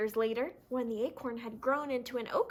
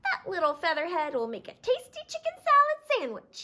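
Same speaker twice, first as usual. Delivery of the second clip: a hint of a higher sly fox voice; clucking fox; 6 semitones higher, 3 dB louder than usual. Fox eyeing Chicken Little, lightly voiced gloating. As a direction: gloat over the meal to come. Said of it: "he clucked".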